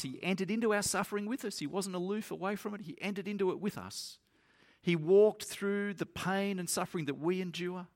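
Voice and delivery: lifted, urgent sermon voice; fervent preaching. Real, not acted. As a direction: preach it with rising fire.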